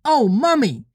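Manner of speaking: put-on high little-boy exclamation; high childish plea; child crying out. A narrator's reading. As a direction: whine up high to Mummy as a small child. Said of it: "cried the first twin".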